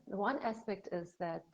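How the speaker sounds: usual speaking voice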